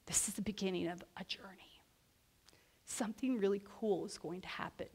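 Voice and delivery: hushed, breathy whisper of awe; whispered thought. Retold or imitated. Sensing the start of a journey, an awed whisper. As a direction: whisper it like a secret hope. Feeling in her stomach.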